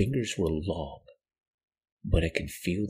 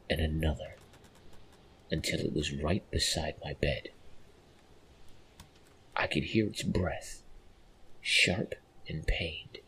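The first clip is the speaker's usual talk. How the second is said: ordinary delivery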